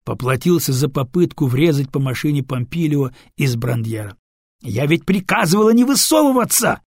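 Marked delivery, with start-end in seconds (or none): 4.6-6.8 high, loud furious outburst; helpless fury. Acted. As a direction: rage at being disobeyed. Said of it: "в бессильной злобе".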